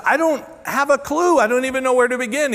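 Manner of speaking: high-pitched, insistent cluelessness; retold helpless protest. Patient tells doctor he knows nothing of weights, protesting. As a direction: protest that you are utterly lost.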